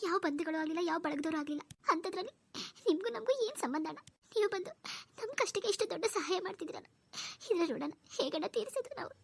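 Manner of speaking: high-pitched tearful thanks in broken phrases; crying with gratitude. Acted. Sister overwhelmed by a friend's big help, crying. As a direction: sob your thanks, pausing to gather yourself. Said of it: "ನೀನ್ ಸುಮ್ನಿರು, ಅಳ್ಬೇಡ".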